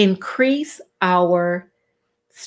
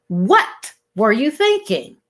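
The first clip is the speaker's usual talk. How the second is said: "What" punched hard and set apart; stress on WHAT; 3 semitones higher than usual. Shown as a demonstration. Showing emphasis on the first word, "What" stressed and separated. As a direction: hit "What", pause, then finish the question. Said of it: "emphasis on the first word".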